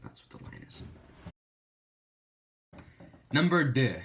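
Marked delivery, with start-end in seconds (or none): 3.3-4.0 mock French. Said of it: "say it in a different language".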